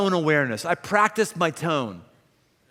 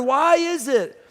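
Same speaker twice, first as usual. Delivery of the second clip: ordinary talk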